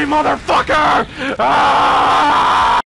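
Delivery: yelling as he's grabbed; shout. Real, not acted. Streamer yells as he gets caught, loud shout. Clip replay of getting grabbed.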